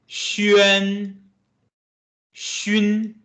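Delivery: xu, xue, xuan, xun as model syllables; teacher modeling Mandarin syllables; Mandarin syllables demo. Shown as a demonstration. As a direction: read each syllable clearly for repetition. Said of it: "Let's read them together".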